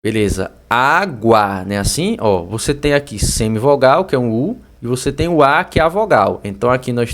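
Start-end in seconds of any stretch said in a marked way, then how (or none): 0.7-1.6 ÁGUA drawn out slowly as "á... gua"; slow classroom sounding-out of água; syllable-by-syllable demo. Shown as a demonstration. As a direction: stretch água into two slow, separate syllables. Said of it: "Percebe que o A tem um som mais forte".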